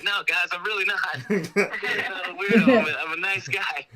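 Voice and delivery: high, laughing denial, pitched well up; laughing protest. Retold or imitated. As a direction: laugh through the protest that you're harmless. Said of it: "And I was like".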